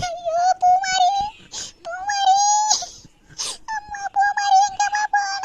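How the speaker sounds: put-on a mother weeping for her missing girl; crying. Mother weeping for her lost daughter, sobbing.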